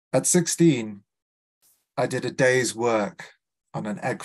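measured, deliberate poem reading; poetry reading. Acted. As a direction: read it like a poem with pauses.

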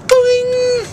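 very high, loud spring noise; comic high-pitched boing; cartoon boing. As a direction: imitate a bouncing spring up high. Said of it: "Mine is just like".

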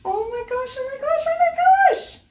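high excited squeal; squealing excitement. Real, not acted. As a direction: squeal with delight. Thrilled by Sarah J Maas tapestry.

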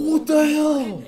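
loud startled what the hell; shocked shout. Real, not acted. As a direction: blurt it out in shock. Reacts with shock to clip.

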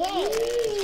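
high, slow goofy voice; silly high voice. Pretend. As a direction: stretch it out, high and playful. Playful pun on crackers.

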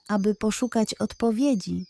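ordinary delivery, nothing marked

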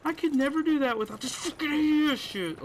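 loud, high, thrilled outburst; high and loud, like you can't believe the shot landed; excited yelp. Real, not acted. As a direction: yell it out amazed at your own play. Hyped after a big play.

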